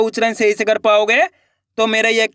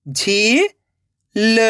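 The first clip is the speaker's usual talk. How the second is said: झील split into झी, long pause, ल; rising झी, then ल alone; syllable by syllable; much slower than usual. Shown as a demonstration. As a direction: sound out झील very slowly in two parts. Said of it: "एक एक अक्षर का उच्चारण करना है".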